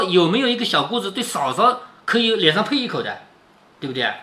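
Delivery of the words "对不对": ordinary talk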